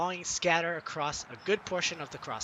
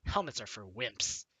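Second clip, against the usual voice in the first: scoffing; 8 semitones lower, 12 dB louder, slower than usual. Retold or imitated.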